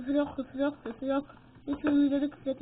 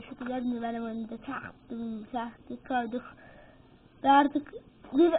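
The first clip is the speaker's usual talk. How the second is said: ordinary delivery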